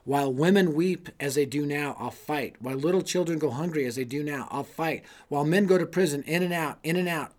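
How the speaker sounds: a stirring quoted vow; rousing quoted speech. Acted. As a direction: proclaim it like a rallying cry. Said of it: "I love this quote from him".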